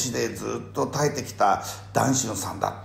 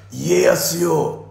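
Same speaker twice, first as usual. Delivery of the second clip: slow loud call; 6 semitones higher, 7 dB louder, much slower than usual. Retold or imitated. Imagining Nobunaga's words.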